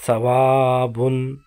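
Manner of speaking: ثوابن drawn out slowly, each syllable clear, ending on the nasal -an; slow recitation demo. Shown as a demonstration. Teacher showing students how to recite, slow and clear. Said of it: "تو کیسے کریں؟".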